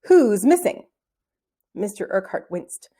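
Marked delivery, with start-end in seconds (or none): 0.0-0.9 loud, carrying schoolteacher's question; penetrating teacher voice. A narrator's reading. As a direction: project it like across a playground. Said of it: "penetrating voice that can only be developed".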